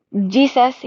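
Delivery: ordinary talk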